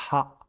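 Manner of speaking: "ha" with a throaty ḥ; Arabic syllable ḥa. Shown as a demonstration. Teacher reads the syllable "ha" with throaty ḥ. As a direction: breathe "ha" from the throat. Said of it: "donc le son".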